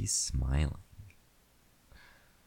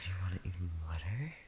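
whispering; 5 dB quieter, much faster than usual. Acted. Intimate whispered roleplay.

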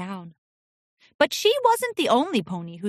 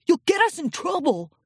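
put-on high, anxious young colt; urgent colt voice; 6 semitones higher than usual. A narrator's reading. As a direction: warn her in a hurry. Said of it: "urged a yellow colt".

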